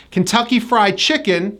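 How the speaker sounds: ordinary talk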